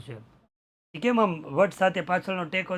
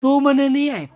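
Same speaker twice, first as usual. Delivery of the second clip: angry refusal; 7 semitones higher, 5 dB louder than usual. Retold or imitated. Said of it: "acted villain to priest".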